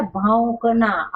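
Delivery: भौंकना slowly, a clear au vowel; slow, careful भौंकना; correct pronunciation. Shown as a demonstration. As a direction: stretch the औ in भौंकना. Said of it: "औ की मात्रा".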